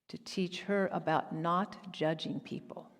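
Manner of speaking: usual speaking voice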